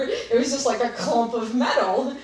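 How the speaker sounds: loud, laughing disbelief; amused storyteller, laughter in the words. Real, not acted. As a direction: talk through a real laugh. Laughs while telling of wrecked door.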